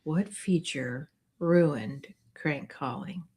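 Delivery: slow and soft, mulling over each word; slow thinking repeat. Shown as a demonstration. Host rereads the quiz question to herself, slow and soft. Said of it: "repeating the quiz question to think".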